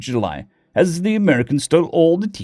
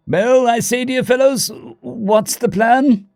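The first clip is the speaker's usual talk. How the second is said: high, slow upper-class British question; posh British accent; 10 semitones higher, slower than usual. A narrator's reading. Diplomat asks the fellows for the plan, posh British accent. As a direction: draw it out like a posh British gentleman. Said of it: "The posh upper-class British accent".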